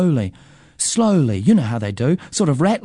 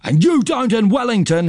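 high-pitched squabbling comeback; acted boyish yell; yelled retort; 8 semitones higher than usual. A narrator's reading. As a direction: fling it straight back, voice raised high. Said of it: "he yells".

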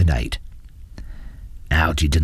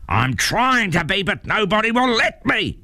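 high, frustrated mock yell; 14 semitones higher than usual. A narrator's reading. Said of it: "yelled Algy".

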